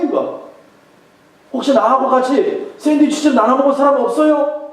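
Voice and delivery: high, loud mock complaint about his wife; loud grumbling call. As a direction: shout it out across the lunch crowd. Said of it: "큰 목소리로 이런 소리가 듭니다".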